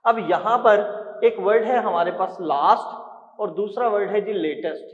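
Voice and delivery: usual speaking voice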